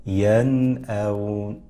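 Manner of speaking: teacher models ينأون with the nun pronounced distinctly; drawn-out yanaawn. Shown as a demonstration. Tajweed teacher demonstrating ينأون for viewers. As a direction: say ينأون slowly, nun clear before the hamza. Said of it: "إذن أقرأ هكذا".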